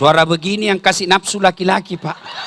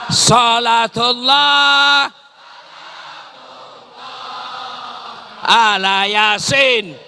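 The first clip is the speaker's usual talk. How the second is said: men shouting salawat; 4 semitones higher, much slower than usual. Acted. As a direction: bellow it out with the men. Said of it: "ayo teriak-teriak semua".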